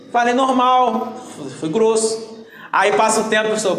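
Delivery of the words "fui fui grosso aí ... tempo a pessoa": ordinary talk, nothing marked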